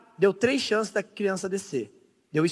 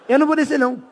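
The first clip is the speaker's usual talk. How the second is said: higher, stubborn frightened refusal; scared high voice; 6 semitones higher, much faster than usual. Retold or imitated. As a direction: dig in, scared and squeaky. Tigas recusando de novo. (voz aguda e assustada teimando)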